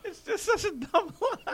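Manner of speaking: high, laughing voice; amused, laughing high. Real, not acted. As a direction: still giggling while talking. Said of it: "He loves it".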